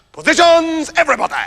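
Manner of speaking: rally the performers into position; ringmaster-like call. Acted. Calls performers into place.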